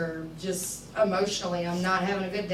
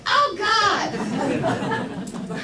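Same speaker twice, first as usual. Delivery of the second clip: much higher, laughing exclamation of panic; laughing oh God; 7 dB louder than usual. Retold or imitated. Nervous speaker acting out her own panic on stage, high laughing squeal. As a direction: squeal it through a laugh, embarrassed. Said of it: "and I'm just like".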